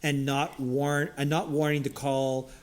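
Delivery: ordinary talk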